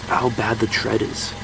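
normal talking voice

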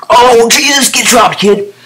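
high, loud gloating yell; excited shout. Real, not acted. Gamer crowing as his opponent gets dropped, excited shout. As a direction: crow loudly over the kill. Gloating over a kill in clip.

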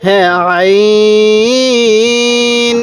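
আইন held long and slow; slow elongated আইন as in Quran recitation; four-alif elongation. Shown as a demonstration. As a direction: draw out আইন for four counts. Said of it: "আইনের মধ্যে আপনি টান দিবেন".

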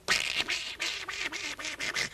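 rapid crisp smacks of a beating; imitated cudgel blows. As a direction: slap out the cudgel strokes. 模仿军棍声. (模仿军棍啪啪声)

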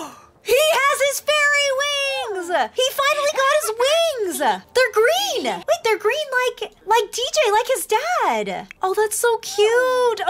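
high, slow, gushing cries of joy; real delight. Real, not acted. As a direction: squeal over the green wings like a proud parent. His son finally gets fairy wings.

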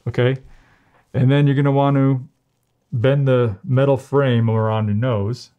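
muffled behind fitted mask; speaking while adjusting mask; through a face mask. Real, not acted. Seen 0: Mask worn over nose and mouth throughout, fitted at nose.

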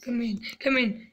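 soft unvoiced whisper. Real, not acted. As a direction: whisper it under your breath. Whispering at the slide top.

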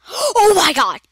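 loud astonished exclamation; real startled oh my god; shocked shout. Real, not acted. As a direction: blurt it out in shock. Monster enters the room.

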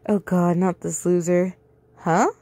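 usual speaking voice

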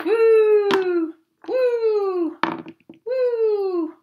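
high, loud "woo", held long; long high whoops, dropping at the end; flying whoop. Acted. Narrator sends animals flying off bridge, high whooping.

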